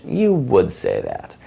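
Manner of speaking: slow, knowing teasing line; sassy tease. Shown as a demonstration. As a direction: drag it out like you've caught her. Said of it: "So it's".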